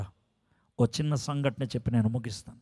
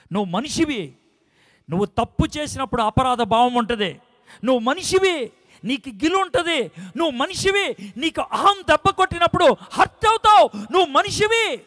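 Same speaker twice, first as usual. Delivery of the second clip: building higher and louder with each refrain; preaching at full force; 11 semitones higher, 10 dB louder than usual. Acted. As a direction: climb to a roaring peak. Escalating sermon refrain.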